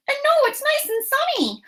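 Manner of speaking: very high put-on helper voice; Rosa. Helper answering the teacher's counting question, high put-on voice. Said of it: "Rosa's gonna hop in".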